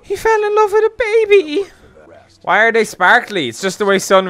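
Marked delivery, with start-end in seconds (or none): 0.0-1.7 high cooing voice, far higher; mock gushing fan. Mocking Twilight fans who gush over imprinting in a high coo. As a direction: coo it like a smitten fangirl. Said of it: "who's gonna sit there and go".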